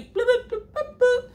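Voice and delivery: softly popped high-pitched bloops; little high blips, like buttons being pressed; high bloop noises. Retold or imitated. Storyteller blocking coworker out, playful high bloops.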